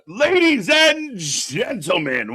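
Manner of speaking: big drawn-out ring announcer call. Acted. Wrestling show announcer intro.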